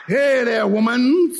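lightly voiced sly tempter, drawn out; a hint of a slick, smooth-talking serpent. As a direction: slide up slyly on the last word. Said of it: "Clever and slick".

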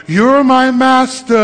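drawn-out, sustained mock devotion; hammed-up adoring disciple. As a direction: stretch it out like a show of worship. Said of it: "you go from".

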